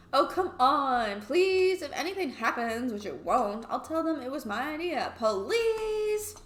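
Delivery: whiny, high, exaggerated pleading; begging [name]. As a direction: beg shamelessly, stretching to a squealed please. [name] begs Sunny.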